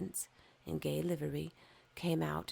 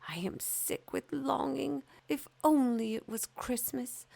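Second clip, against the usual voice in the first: higher, swinging from whispers to cries; aching with longing; yearning fir tree; 7 semitones higher than usual. Acted. Fir tree pouring out its longing to the sun, yearning and rising.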